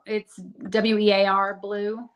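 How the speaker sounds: "W E A R Blue" spelled letter by letter, slowly. Shown as a demonstration. Spelling the name for the listeners, slow and letter by letter. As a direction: spell out "W E A R", each letter its own beat. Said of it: "Wear Blue".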